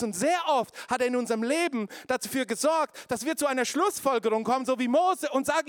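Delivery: high, loud, driving preacher voice; preaching at full force. Acted. Preacher rousing the church about the enemy, full force. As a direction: hammer it home to the congregation.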